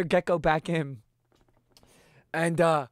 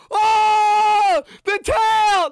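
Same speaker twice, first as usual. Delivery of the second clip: much higher, louder horrified exclaim; shocked cry; 12 semitones higher, 8 dB louder, much slower than usual. Retold or imitated. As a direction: cry out in disbelief. Both shocked by the twitching tail.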